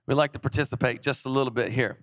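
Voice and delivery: normal talking voice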